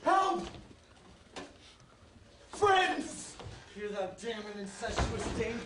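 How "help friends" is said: loud desperate cry for help; shouting for help. Acted. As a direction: call out high and loud. Crying out for help.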